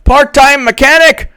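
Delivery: high, mocking know-it-all whine; know-it-all theatergoer. Mocking a theatergoer who heckles the play, high whine. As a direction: pitch it up high to mock a pedant in the audience. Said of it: "and do you say".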